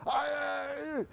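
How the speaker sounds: high, long mock scream; hammed-up wordless parental shriek; exasperated scream. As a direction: let out a high cry of helpless frustration. Parent's speechless scream ends quarrel.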